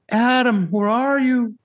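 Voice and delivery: high, loud, longing call; plaintive cry of love. Retold or imitated. God calling out to Adam, plaintive high cry. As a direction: call him home with love. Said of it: "a kind of a plaintive cry of love".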